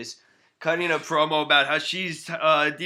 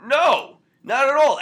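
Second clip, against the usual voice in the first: higher and louder, cutting him off; emphatic loud no; 10 semitones higher, 7 dB louder than usual. Real, not acted. Arguing with co-host about Xena, loud and sharp. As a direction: shut the argument down hard. Heated argument over Xena.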